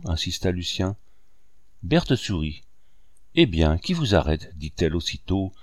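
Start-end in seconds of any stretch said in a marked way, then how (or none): none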